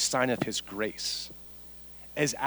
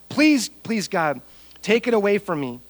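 pleading; 5 semitones higher, 4 dB louder than usual. Acted. Acting out Paul's pleading with God.

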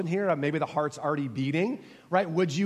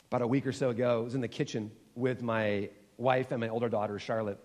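ordinary talk, nothing marked

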